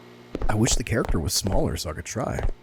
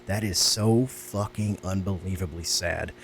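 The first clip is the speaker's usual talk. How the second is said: touched; 5 semitones lower, 4 dB quieter, slower than usual. Real, not acted. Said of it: "I'm gonna cry".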